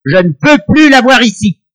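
loud, high, cruel order; harsh stepmother. Acted. Stepmother orders girl abandoned.

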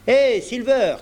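shouting to Silver across the stockade; calling out. A narrator's reading. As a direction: hail him from afar. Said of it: "cria-t-il".

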